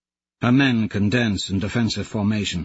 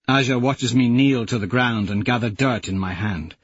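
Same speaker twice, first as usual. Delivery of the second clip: usual speaking voice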